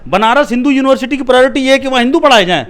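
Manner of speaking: loud, high rhetorical question; real incredulous, heated retort; indignant challenge. Real, not acted.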